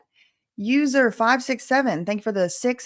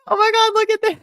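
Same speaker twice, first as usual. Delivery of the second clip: much higher and louder, laughing with joy; squealing excitement; 8 semitones higher, 5 dB louder, much slower than usual. Real, not acted. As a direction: shriek with delight at the screen. Sees huge donations, shows fiance.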